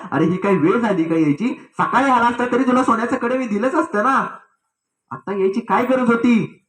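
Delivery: a light rebuke with a sharp edge. Acted. Queen mother sharply chiding the boy who came at midnight. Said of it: "राजमाता जरा तीक्ष्ण आवाजात बोलल्या".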